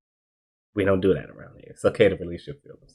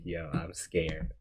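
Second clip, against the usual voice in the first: scared; 2 semitones lower, 12 dB quieter, much slower than usual. Retold or imitated. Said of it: "I literally said, "I'm scared".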